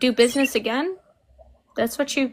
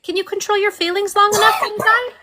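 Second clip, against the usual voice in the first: fast, higher mocking jab; taunting; 6 semitones higher, 4 dB louder than usual. Real, not acted. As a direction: needle him, louder and higher. Taunting mid-argument.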